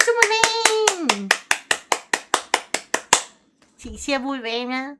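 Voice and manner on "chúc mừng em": high, very slow drawn-out "chúc mừng em"; sing-song congrats. Pretend. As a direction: stretch it out sweetly high.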